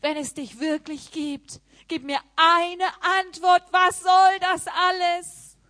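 loud, desperate cry to God, high and strained; screamed prayer. Retold or imitated. Woman in despair crying out to God for an answer, loud. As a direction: yell it up at heaven, demanding an answer. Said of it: "Ich habe wirklich geschrien".

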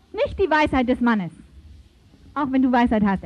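ordinary talk, nothing marked

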